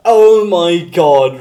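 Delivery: real delight, drawn out loud; delighted exclamation. Real, not acted. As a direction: belt it out in delight. Delighted reaction to chat.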